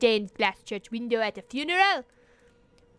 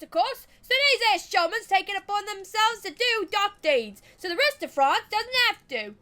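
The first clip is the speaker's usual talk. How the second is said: higher Australian yarn-spinner; lightly voiced Australian; 7 semitones higher than usual. Eddie explaining void shamans to the witch, light Australian accent. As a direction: spin the legend like a cheeky Australian bloke.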